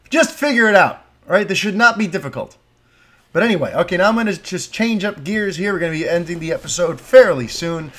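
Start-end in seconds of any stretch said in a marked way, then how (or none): 0.1-2.6 higher and louder, fed-up; exasperated demand. Real, not acted. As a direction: snap at the studio to sort it out.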